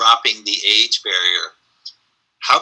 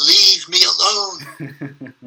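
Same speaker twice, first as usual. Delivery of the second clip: very slow, drawn-out, heavy; weary dismissal; 6 semitones higher, much slower than usual. Retold or imitated. Olivier turning away from his dresser, very slow and drawn-out. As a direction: drag each word out like he's spent. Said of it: "he turned and he said".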